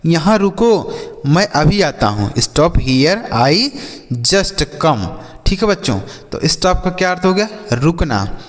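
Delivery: ordinary talk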